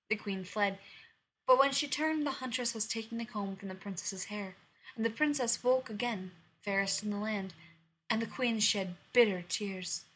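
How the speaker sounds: usual speaking voice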